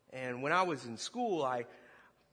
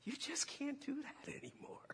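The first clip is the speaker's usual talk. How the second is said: gentle whisper; 10 semitones higher, 10 dB quieter than usual. Retold or imitated. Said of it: "he's like".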